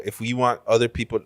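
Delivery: ordinary talk, nothing marked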